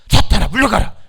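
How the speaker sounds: loud, forceful shout; commanding rebuke. Shown as a demonstration. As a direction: shout down the devil. Preacher models rebuke for congregation. (사탄을 쫓는 힘찬 외침)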